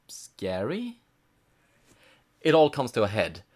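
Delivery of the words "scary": whispered, mock-spooky question; sarcastic whisper. Real, not acted. Mocking the bedsheet scare.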